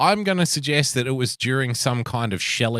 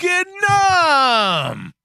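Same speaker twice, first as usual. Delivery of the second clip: hyped shout. Acted. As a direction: yell it like a stadium announcer. Hosts hype rankings by country.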